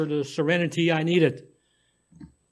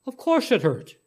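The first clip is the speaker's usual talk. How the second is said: snapping; 7 semitones higher than usual. A narrator's reading. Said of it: "I snapped".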